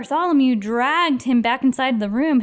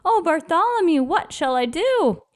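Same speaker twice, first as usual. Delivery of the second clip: wailing; 8 semitones higher than usual. Acted. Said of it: "the trumpeter wailed".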